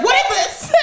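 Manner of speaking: very high mock bewilderment, laughing; baffled mom. Mom asking her kid about the plus, baffled. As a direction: squeal it as a baffled mother.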